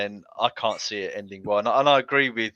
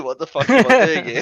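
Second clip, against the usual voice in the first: louder, higher, laughing through the imagined thought; laughing mock disbelief; 4 semitones higher, 10 dB louder than usual. Panellist joking about a man called in on his day off, laughing mock disbelief. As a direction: crack up while voicing mock bewilderment.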